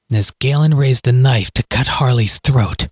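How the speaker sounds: ordinary delivery, nothing marked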